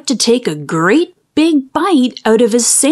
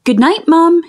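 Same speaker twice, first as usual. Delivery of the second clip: higher, lightly called good night; calling out; 6 semitones higher than usual. A narrator's reading. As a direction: call it to another room. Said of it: "he called".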